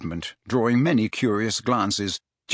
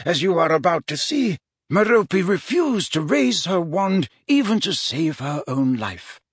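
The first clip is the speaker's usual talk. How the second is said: higher-pitched, deliberate wizard voice; measured storytelling mentor; 7 semitones higher than usual. Acted. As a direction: recount the history like a learned mentor. Wizard reasons with his pupil.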